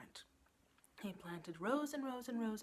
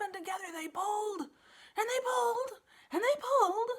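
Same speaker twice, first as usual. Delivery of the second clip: rising higher and louder each pull; building strain; 12 semitones higher, 9 dB louder than usual. A narrator's reading. Narrator building the pulling, much louder at the end. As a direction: heave harder each time.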